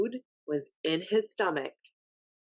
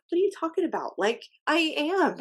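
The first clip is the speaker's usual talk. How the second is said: exasperated disbelief; 10 semitones higher, faster than usual. Retold or imitated. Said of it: "And I'm like".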